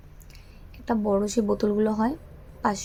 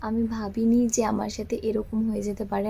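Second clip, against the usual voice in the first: ordinary talk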